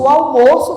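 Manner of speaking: "almoço" as noun, with closed stressed O. Shown as a demonstration. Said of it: "o substantivo almoço".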